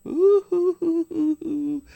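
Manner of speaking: loud, high fake boohoo sobs, no tears; hammed-up sobbing with breathy hoots; mock sobbing. Retelling a suspect's fake tears, mock loud sobbing. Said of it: "And he starts sobbing".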